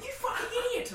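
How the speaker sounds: high incredulous shout; mock exasperated yell. Mates scolding her at the pub, high exasperated shout. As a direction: yell at a friend who blew it.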